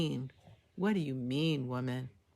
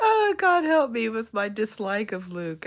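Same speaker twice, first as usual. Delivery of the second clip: mock exasperated plea; 6 semitones higher, 9 dB louder than usual. Real, not acted. Exasperated plea about disliked character.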